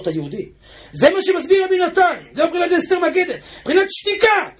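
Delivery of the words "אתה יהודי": low, quiet reminder; soft telling. Acted. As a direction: say it softly, almost a secret. Mordechai wakes him, retold softly.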